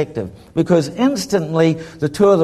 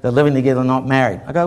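ordinary delivery

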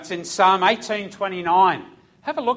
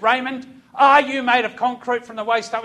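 louder, higher challenge; confronting; 6 semitones higher, 6 dB louder than usual. Retold or imitated. Sergeant challenges Raymond, louder. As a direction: get in his face.

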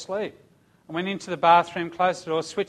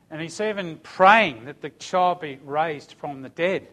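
normal talking voice

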